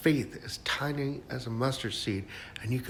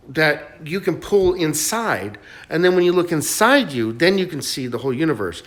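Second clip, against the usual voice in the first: louder and higher, rushing with enthusiasm; excited, carried away; 7 semitones higher, 8 dB louder than usual. Real, not acted. Urging listeners to imagine anything, excitedly loud. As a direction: get swept up and push it out. Enthusiastic cosmic rant continues.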